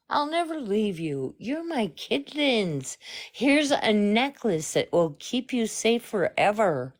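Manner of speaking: mock a sugary, fake babysitter voice; weird fake voice. Worried critic quoting the babysitter's promises to kids, weird fake voice. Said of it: "it's this weird fake voice".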